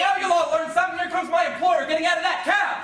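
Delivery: high, loud triumphant threat; shouted warning. Acted. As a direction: shout it over the uproar. Employee warning of employer's arrival.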